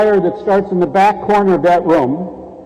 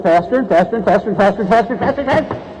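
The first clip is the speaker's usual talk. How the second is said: speeding up; much faster than usual. Acted. Treadmill speeding up.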